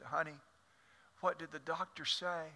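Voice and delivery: soft, frail sick husband asking; small weak voice. Acted. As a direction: barely get the words out. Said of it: "In a small voice, weak voice".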